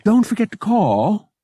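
high, loud parting plea; urging. A narrator's reading. Bria seeing Dale out the door, urging. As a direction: urge it, pitched well up. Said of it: "Bria urged".

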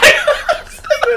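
high, loud cackle of a laugh; loud burst of laughter. Real, not acted. Cracking up at the dad's phone line, loud laugh. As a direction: let out a big real laugh.

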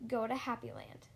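ordinary delivery

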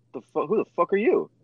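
high, startled voice of a rudely woken Marine; groggy startled Marine. Retold or imitated. Squad leader retells Patrick waking to his rack being kicked, high startled voice. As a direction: yelp it out as if jolted from sleep.

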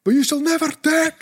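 high, slow, screaming mock battle speech; hammed-up Braveheart war cry to rally the kids; William Wallace. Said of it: "Like that, and I'm just screaming".

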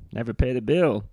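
normal talking voice

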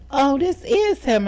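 very high, put-on soprano voice; mock soprano. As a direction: answer the phone in an exaggerated soprano. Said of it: "gave me soprano on that phone".

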